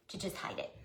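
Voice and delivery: whispered afterthought; soft whispered aside. Real, not acted. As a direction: breathe it out unvoiced.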